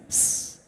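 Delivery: unvoiced hissing tss, hi-hat style. Shown as a demonstration. Teaching the crowd the hi-hat sound, hissed tss. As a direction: hiss through the teeth like a cymbal. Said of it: "It's like you're hissing".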